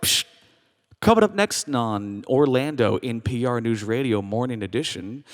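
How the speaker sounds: monotone radio newsreader. Retold or imitated. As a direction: read grim news in an unvarying low murmur. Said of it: "delivered in the same monotone voice".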